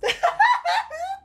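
high giggling burst; nervous laugh. Real, not acted. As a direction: laugh off the excitement. Risa aguda nerviosa. (risa nerviosa aguda)

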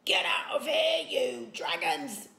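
high, loud hoarse yell at the dragons; yelled order. A narrator's reading. Chief Stoic drives the dragons out: loud yell. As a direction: shout them out of the room. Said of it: "yelled Stoic".

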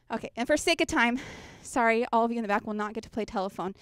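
normal talking voice

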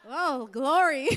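drawn-out, loud laughing cry; laughing praise, stretched out; laughing exclamation. Real, not acted. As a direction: break into laughter on a slow exclamation. Laughing at the quiet audience.

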